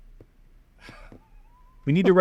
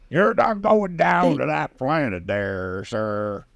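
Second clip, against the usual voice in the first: put-on slow, gruff old sea-captain voice; salty sea captain; much slower than usual. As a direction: growl it slowly like an old salt. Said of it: "a salty sea captain".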